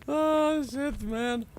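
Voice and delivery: high, loud oh shit; shocked exclamation. Real, not acted. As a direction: yelp it in surprise. Shocked at the anomaly.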